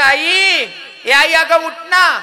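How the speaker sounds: put-on high, loud pleading wail of a small boy; child's wailing plea. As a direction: cry out to wake mother. Boy wakes dead mother. (रडत आईला हाक मारणारा मुलगा)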